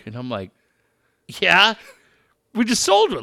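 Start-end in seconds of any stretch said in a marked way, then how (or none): 1.4-3.2 high, loud; surprised eager clerk; mock eager surprise. Telling Dave his surprised answer to the kid, high and eager.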